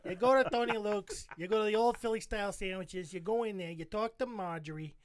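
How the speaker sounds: Philly local giving ordering instructions; mock Philadelphia regular; Philadelphia accent. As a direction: give directions in a hammed-up Philly accent. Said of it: "You go up to anybody in Philly".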